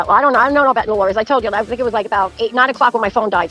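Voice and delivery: usual speaking voice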